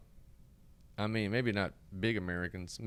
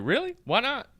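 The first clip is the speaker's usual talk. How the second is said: high incredulous really why not; mock surprise; 14 semitones higher, 6 dB louder than usual. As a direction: act baffled. Gangsters quoted surprised.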